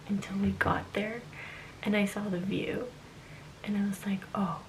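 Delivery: whispering. Real, not acted. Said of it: "I have to whisper because".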